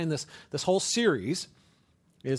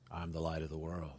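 low, soft, staring intense voice; intense stranger; 11 semitones lower, 12 dB quieter than usual. Retold or imitated. Stranger locking eyes in conversation, low and eerie. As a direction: lock eyes and say it low and creepy. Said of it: "and they're like".

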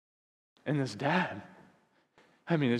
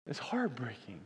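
soft unvoiced whisper; whispered sorrow; 2 semitones lower, 8 dB quieter than usual. Real, not acted. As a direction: let it fall to a hushed whisper. After sad story, drops voice.

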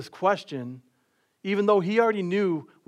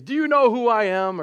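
indignant driver; 6 semitones higher, 6 dB louder than usual. Retold or imitated. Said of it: "the first question they would ask me".

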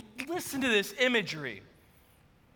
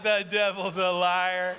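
low, slow, deadpan aside; mock indignation; 5 semitones lower, slower than usual. Husband reacts to wife's casual greeting, mock-indignant. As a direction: drop the pitch and draw it out in comic disbelief.

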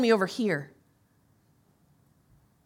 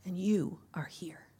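whispered prayer; 4 semitones lower, 7 dB quieter than usual. Real, not acted. Pastor praying quietly over the congregation, whispered. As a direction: pray it under your breath.

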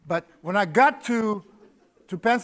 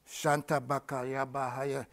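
imitating tongues; 5 semitones lower, 10 dB quieter, slower than usual. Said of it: "casting stuff out".

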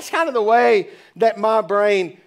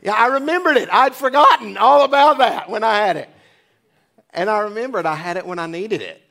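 normal talking voice

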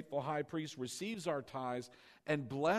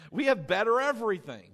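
excited preaching; 7 semitones higher, 10 dB louder than usual. Real, not acted. Preacher rousing congregation.

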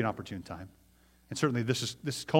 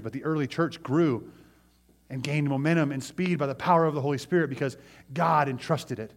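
usual speaking voice

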